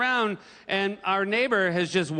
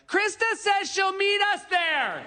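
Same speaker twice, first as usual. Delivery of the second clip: put-on drunken yell; slower than usual. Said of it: "you hear someone yell something like".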